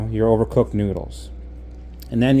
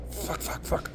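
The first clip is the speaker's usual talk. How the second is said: panicked curses whispered under the breath; real frightened whisper; whispered cursing; 13 dB quieter, slower than usual. Real, not acted. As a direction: whisper the swearing to yourself in fear. Panicking mid-exposure, cursing.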